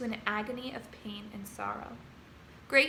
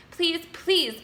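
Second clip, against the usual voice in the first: begging; 5 semitones higher, 6 dB louder than usual. A narrator's reading. Wilbur begging the rat.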